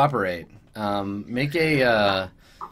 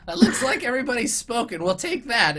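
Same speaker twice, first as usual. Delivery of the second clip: smug goblin lawyer; 10 semitones higher than usual. Acted. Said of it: "he turns around and he's like".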